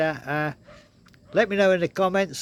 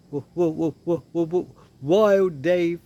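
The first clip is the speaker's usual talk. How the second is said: stuck on the w, then a louder Wild Dave; imitating a stutter; 3 dB louder than usual. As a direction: stammer the w like the coworker. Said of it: "he used to stutter".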